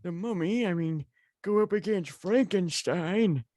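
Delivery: put-on thin, reedy old man voice; a wavering elderly voice. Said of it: "That's my old man voice".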